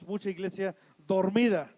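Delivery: ordinary talk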